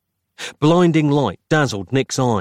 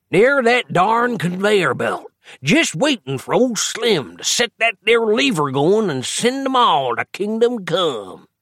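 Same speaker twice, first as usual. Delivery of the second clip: put-on old man voice; 10 semitones higher than usual. A narrator's reading. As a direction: play a rambling old timer.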